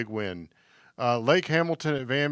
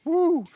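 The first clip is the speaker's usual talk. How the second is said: high, loud cheer of delight; excited whoop; 4 dB louder than usual. Real, not acted. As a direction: whoop it up for the pick. Cheering a co-host's pick.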